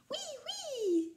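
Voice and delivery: very high, held-out joyful cry; delighted child. A narrator's reading. Rikili cheering about the letters, very high and joyful. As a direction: squeal with joy. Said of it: "se réjouit Rikili".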